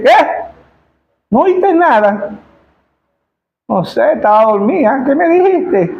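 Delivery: high, slow confused voice of a just-woken bride; groggy bride. Acted. Sleepy bride answering her fiancé, high and confused. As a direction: sound like she just woke up, lost.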